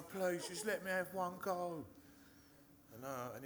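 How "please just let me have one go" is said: higher, slow, drawn-out begging; pleading kid. Retold or imitated. Little brother begging at the door for one go, pleading and slow.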